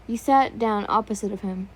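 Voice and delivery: usual speaking voice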